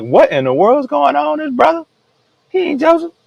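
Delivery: very high-pitched disbelieving voice; mock incredulous. Brothers reacting to Joseph's reveal, mock disbelief. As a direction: imitate stunned brothers in a squeaky-high pitch.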